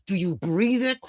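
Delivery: understated intense ask; mock intense question. First take of repeated question.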